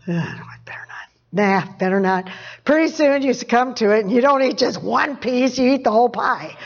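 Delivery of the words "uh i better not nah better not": half-whispered second thoughts; hushed hesitation. Acted. Preacher acting a dieter resisting pie, hushed and hesitant. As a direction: waver under your breath. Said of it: "and you go".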